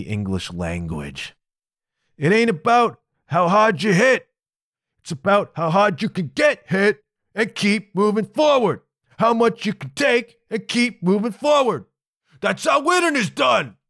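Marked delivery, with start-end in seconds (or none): 2.2-4.3 high, loud tough-guy boxer voice; Rocky impression. Rocky lecturing his whiny kid, high loud tough-guy voice. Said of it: "That was Rocky". 5.0-8.8 a beaten champ refusing to quit; Rocky impression. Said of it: "That was Rocky". 9.2-13.8 a fighter father's rising pep talk; Rocky impression. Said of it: "That was Rocky".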